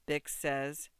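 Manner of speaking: ordinary delivery, nothing marked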